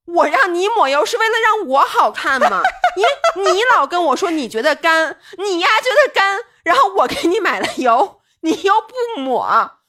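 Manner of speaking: half-laughing, mock angry at him; retold exasperation. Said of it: "我就急了".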